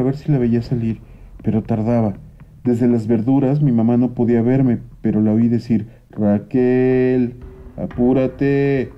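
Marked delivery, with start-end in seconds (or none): 6.1-8.9 drawn-out, very slow mother's call from afar. Retold or imitated. Mother calls Raquel from the vegetables, slow drawn-out call. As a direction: stretch her name out, calling her daughter across the store. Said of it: "Seguro que le gritó".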